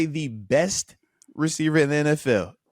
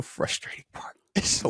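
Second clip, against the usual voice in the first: frustrated whisper; 7 dB quieter than usual. Real, not acted. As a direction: let the frustration drop to a whisper. Venting frustration at repeated mistakes.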